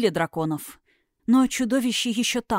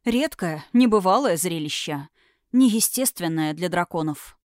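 ordinary delivery

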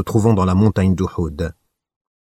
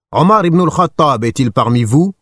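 higher, louder call across the valley; 6 semitones higher, 4 dB louder than usual. A narrator's reading. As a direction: shout down from the mountaintop. Abu Sufyan calls from mountain. (appel lancé plus haut et plus fort)

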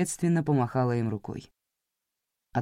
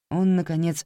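ordinary delivery, nothing marked

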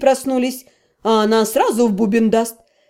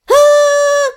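very high, loud scream; 13 semitones higher, 4 dB louder than usual. A narrator's reading. Вики орёт, чтобы прекратить дурдом. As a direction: scream to stop the madhouse. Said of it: "громко заорала я".

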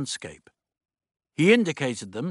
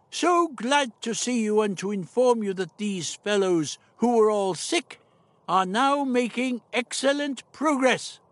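put-on old doctor voice; 10 semitones higher than usual.

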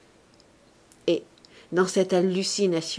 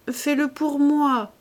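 higher, slow, fervent entreaty; ardent plea; 8 semitones higher, slower than usual. A narrator's reading. Wife begging her husband to leave for Paris, ardent plea. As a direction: plead ardently, drawing the words out. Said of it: "reprit-elle ardemment".

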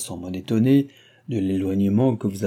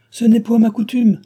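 much higher, much louder irritated cry; stern guardian flares up at the young woman; arrogant angry outburst; 13 semitones higher, 10 dB louder than usual. A narrator's reading. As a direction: bristle with offended pride. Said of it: "s'écria-t-il arrogamment et très irrité".

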